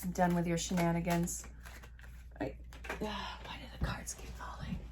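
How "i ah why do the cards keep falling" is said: breathy whisper to herself; whispered aside. Real, not acted. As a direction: mutter it under your breath. Muttering to herself over falling cards.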